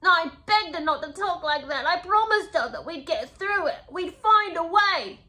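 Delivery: high, loud, hammed-up melodrama; mock-desperate pleading. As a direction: plead like an overwrought soap hero. Mockingly acting out the memoir's dramatic pleading.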